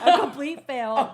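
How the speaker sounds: loud, high, laughing repeat; laughing disbelief. Retold or imitated. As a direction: quote it loudly through laughter. Repeats nurse's phrase in disbelief, laughing.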